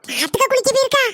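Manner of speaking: high, incredulous repeat of போயிருக்கா; daughter-in-law can't believe she went bathing; astonished question. Acted. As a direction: disbelief rising on போயிருக்கா. Repeats news, more astonished.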